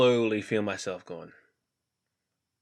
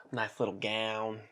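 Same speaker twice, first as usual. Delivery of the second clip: usual speaking voice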